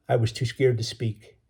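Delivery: plain reading voice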